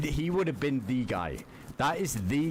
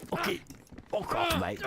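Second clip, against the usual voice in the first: higher British lad jeer; mock British accent. Shown as a demonstration. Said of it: "that Game of Thrones accent".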